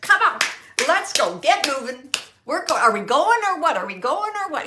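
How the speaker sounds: loud, high-pitched nagging; impatient urging. Retold or imitated. Woman hurrying slow man, acted.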